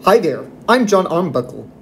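ordinary delivery

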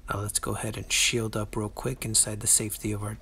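ordinary talk

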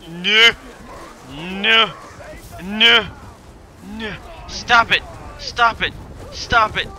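high, loud repeated protests. Real, not acted. Player telling enemies to stop, high loud protest. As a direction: cry out each one at the attacker.

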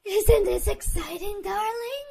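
loud, giddy and unhinged; thrilled and too loud; loud giddy glee. Acted. Yandere girl squealing at her captive, loud and giddy.

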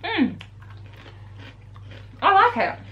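talking while chewing snack; muffled words between bites; mouth full chewing. Real, not acted. Seen 0: Both ate a handful at -5; her jaw keeps moving while speaking.

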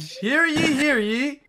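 loud, high town crier call; mock royal decree. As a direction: proclaim it like a herald. Calling out again, town crier.

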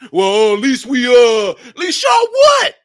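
mock defensive reply. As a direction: shout their excuse back in a put-on voice. Mocking the community's answer.

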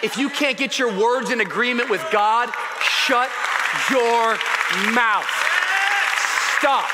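loud, emphatic command from the pulpit; forceful preaching. Acted. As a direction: hammer it word by word, with pauses between.

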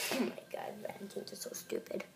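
breathy secret aside; whisper. Acted. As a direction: whisper it like a confession.

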